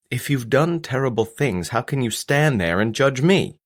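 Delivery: hurt, heated confrontation; indignant challenge. Acted. Son challenges his father's judgement.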